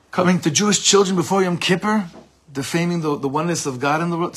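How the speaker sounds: retold screaming. Teacher re-enacting the rabbi accusing the missionary, mock yell. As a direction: imitate an outraged accusation, pushed high. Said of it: "screaming at him".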